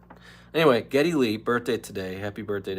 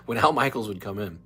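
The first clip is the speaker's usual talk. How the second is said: usual speaking voice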